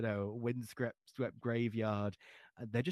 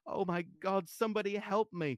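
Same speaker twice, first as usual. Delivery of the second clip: high, sobbing moan; 11 semitones higher than usual. A narrator's reading. Mother crumpling against the priest, high sobbing moan. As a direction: moan it through the sobs. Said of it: "Chris moaned as, sobbing convulsively".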